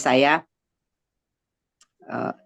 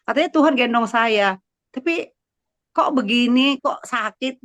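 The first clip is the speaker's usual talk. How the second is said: higher, louder protest to God; retold hurt complaint; 6 semitones higher, 4 dB louder than usual. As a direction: replay a bewildered, wounded plea. Replaying her complaint to God. (menirukan protes terluka kepada Tuhan)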